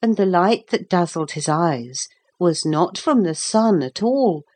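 ordinary delivery, nothing marked

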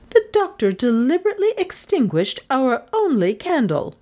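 put-on high, accusing old lady voice; accusing spinster. A narrator's reading. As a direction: point the finger at him. Cornelia accuses the doctor.

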